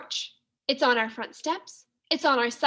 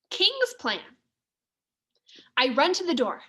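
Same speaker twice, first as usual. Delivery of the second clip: plain reading voice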